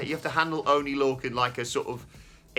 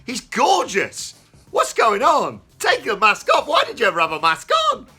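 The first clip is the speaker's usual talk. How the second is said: much higher and louder gushing; gushing excitement; 11 semitones higher, 9 dB louder than usual. Real, not acted. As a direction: swoon over him out loud. Gushing over a handsome wrestler.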